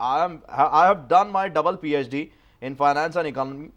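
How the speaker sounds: very slow, drawn-out boast; pompous slow English. Acted. Boasting of his double PhD, pompous slow drawl. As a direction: drag every word out like a self-important scholar. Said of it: "ایک پڑھا لکھا بندہ تم سے بات کر رہا ہے".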